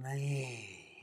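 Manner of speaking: long, soft humming "Me" felt in the chest; soft resonant me. Shown as a demonstration. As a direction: hum "Me" gently into the chest.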